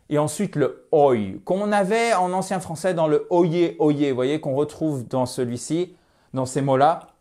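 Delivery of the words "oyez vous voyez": ordinary delivery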